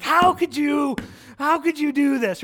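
reliving road rage at his daughter; angry outburst. Retold or imitated. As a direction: vent at her in frustration. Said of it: "I did kinda hit the steering wheel".

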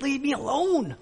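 high, soft, strained plea; hushed retort. Retold or imitated. Nervous husband to wife in a crowded elevator, hushed retort. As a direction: plead in a high, small, hushed voice.

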